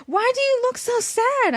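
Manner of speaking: high yelled question. A narrator's reading. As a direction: call out over the helicopter. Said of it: "I yelled".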